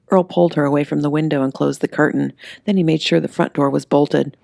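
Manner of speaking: usual speaking voice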